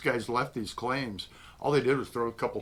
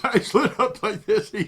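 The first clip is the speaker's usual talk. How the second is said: laughing; 11 semitones higher, 7 dB louder than usual. Real, not acted. Delighted telling of beer offer.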